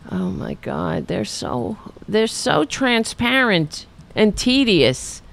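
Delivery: normal talking voice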